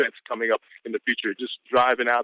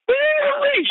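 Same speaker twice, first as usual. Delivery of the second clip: high whooping cheer, much louder; hype whoop; 5 dB louder than usual. Real, not acted. Business partner whooping at the team call. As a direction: let out a big excited whoop.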